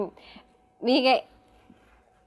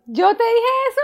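sorpresa; 11 semitones higher than usual. Real, not acted. Oye que ella lo predijo.

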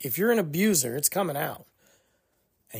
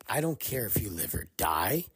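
whispered menace; 7 semitones lower, 7 dB quieter than usual. Retold or imitated. The abuser's inner voice to her husband, whispered contempt. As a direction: drop to a softer, unvoiced whisper of contempt.

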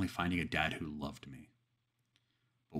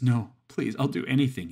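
high, loud desperate begging; 7 semitones higher, 9 dB louder than usual. A narrator's reading. Father on his knees begs Satan for mercy. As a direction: plead for your life. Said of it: "he begged".

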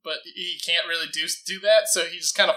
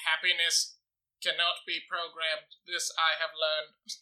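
quiet robot voice; 8 dB quieter than usual. Acted. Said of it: "robot wisdom".

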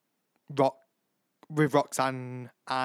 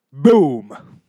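catchphrase shout; 15 dB louder than usual. Retold or imitated. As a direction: bellow it like a catchphrase. Quoting [name] catchphrase.

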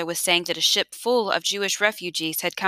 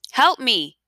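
raised, higher call for help; yelled plea; 5 semitones higher, 4 dB louder than usual. A narrator's reading. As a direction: yell to draw attention from the water. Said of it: "he yelled".

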